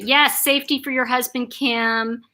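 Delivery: ordinary delivery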